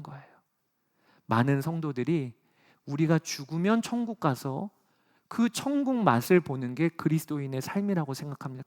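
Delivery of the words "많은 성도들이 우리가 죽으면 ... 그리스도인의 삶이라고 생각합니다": ordinary delivery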